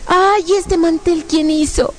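high, drawn-out cooing; gushing relatives. Retold or imitated. Uncles admiring sister's handiwork, gushing. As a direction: coo like a doting visitor, pitched high.

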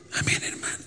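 unvoiced whispered amen; friend's whispered reply. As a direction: breathe the amen softly.

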